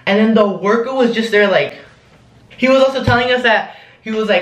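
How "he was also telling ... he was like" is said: plain reading voice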